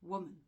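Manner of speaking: "woman" stressed at the start, WO-man; stress on first syllable. Shown as a demonstration. Teacher showing where the stress goes, first part stressed. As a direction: lean on the first part of "woman", let the second fall. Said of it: "stress is on the first part".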